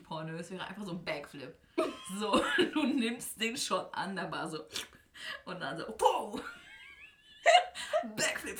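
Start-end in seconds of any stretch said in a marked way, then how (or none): 5.9-8.6 excited. Pretend. Acting out a club backflip.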